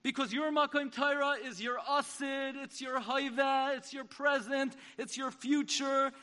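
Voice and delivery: building, loud exhortation; rally the congregation, rising; fervent sermon peak; preaching climax. Real, not acted.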